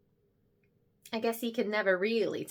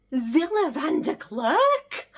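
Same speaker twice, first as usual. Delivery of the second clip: high, slow, drawn-out name; starstruck disbelief; 7 semitones higher, 6 dB louder, slower than usual. Acted. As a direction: savour the name in awe.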